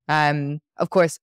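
ordinary talk, nothing marked

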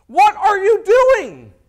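loud, high, drawn-out outraged shout; enraged. Retold or imitated. Pharisees confronting Jesus over his dinner guests, enraged. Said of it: "They're enraged".

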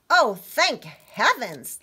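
loud, slow, swooping exclamation; mildly acted joyful relief; laughing relief. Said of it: "Little Red laughed".

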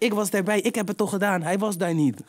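high mock voice of the boy confessing; mocking the snitch. Mimicking the boy's court confession, high mock voice. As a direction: pipe up high as the caving witness. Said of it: "Gewoon letterlijk zo".

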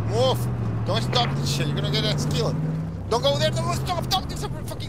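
high panicked shouting at the driver; panicked yelling. Real, not acted. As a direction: scream orders in rising panic.

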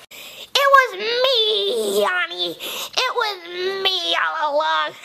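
drawn-out gloating reveal; villainous reveal. Acted. Clone unmasks itself to Yanni, gloating like a villain. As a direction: stretch the words out slowly and triumphantly.

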